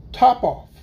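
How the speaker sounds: coolly offhand cat. A narrator's reading. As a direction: say it coolly, unbothered. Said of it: "said the cat quite coolly".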